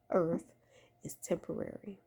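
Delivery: ordinary talk, nothing marked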